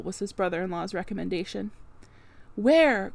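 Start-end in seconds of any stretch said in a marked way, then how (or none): 2.5-3.1 a sharp, loud alarmed cry; cried out. A narrator's reading. Servant cries out as the gentlemen retreat, loud alarm. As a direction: call "Where?" out in alarm. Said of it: "cried Grace".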